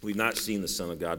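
ordinary delivery

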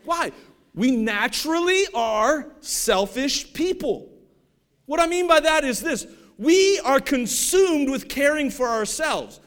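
loud, slow, high-pitched preaching; full-force preaching. Acted. Preacher pressing the congregation, booming and slow. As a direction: drive each line home to the pews.

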